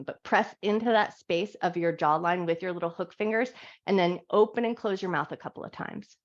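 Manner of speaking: calm, gentle guiding voice through each stretch; guided yoga instruction. Acted. Yoga teacher guiding her class through a wake-up stretch, calm guided voice.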